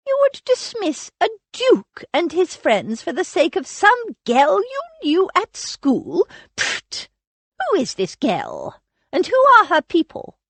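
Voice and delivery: put-on imperious elderly aunt, scornful and sharp; a grand dame dismissing the girl's excuse; haughty old aunt. Aunt Agatha scolding Lily.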